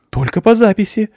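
high, loud officious protest; flustered receptionist. A narrator's reading. Receptionist hurriedly blocks the visitors, high and loud. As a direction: jump up and block them. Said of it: "подскочила женщина".

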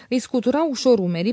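ordinary delivery

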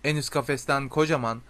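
ordinary delivery, nothing marked

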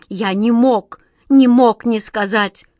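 loud, insistent self-defence; distressed justification; anguished pleading. A narrator's reading. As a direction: plead emphatically, slowing on the repeat. Weeping genie defends himself to master.

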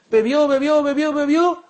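high, loud, rising count; excited narration. A narrator's reading. Preacher telling how Abu Huraira kept drinking, excited. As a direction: pile up the excitement.

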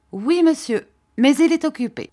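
put-on a high, brash servant boy's voice; cheeky boy. A narrator's reading. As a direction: pert young servant, answering back.

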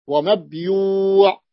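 ومبيوع stretched slowly, the two long vowels held; ومبيوع drawn out slowly; drawn-out mabyu'. Shown as a demonstration. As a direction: spell out ومبيوع slowly by ear. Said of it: "لدينا واوان مدان".

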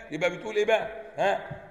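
ordinary delivery

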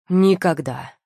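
one loud, final slammed word; flat final verdict. A narrator's reading. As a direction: stamp it down like a seal. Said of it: "припечатал Виллиан".

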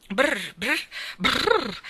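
muffled shivering brr. A narrator's reading. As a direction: shiver it through the brushes. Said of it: "diceva la voce ... attraverso le spazzole".

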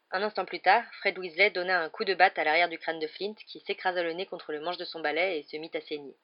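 plain reading voice

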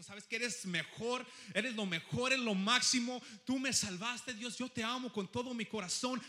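shouted praise. Acted. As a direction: shout it out loud and high, full of love. Voicing passionate praise to God. (alabanza a gritos, con todo el corazón)

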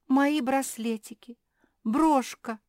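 high, loud wail of grief; distraught lament. A narrator's reading. Kapitolina mourns her bracelets and brooch, lamenting loudly. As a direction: wail over each lost treasure. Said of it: "убивалась Капитолина".

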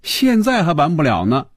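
high-pitched character voice; young woman's character voice. Acted. Woman telling someone to hold on, high character voice. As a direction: voice her in a high register.

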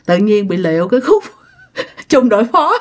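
real laughter breaking into the words; giggling apology. Real, not acted. Storyteller apologising to viewers for the slip, giggling.

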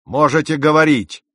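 loud, high-pitched bark; sudden impatient shout; barked order. A narrator's reading. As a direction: snap the command at the silent youth. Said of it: "рявкнул капитан".

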